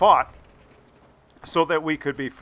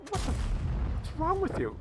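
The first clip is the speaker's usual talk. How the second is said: mock disbelief; 12 semitones higher, 8 dB quieter, faster than usual. Inner reaction to girls.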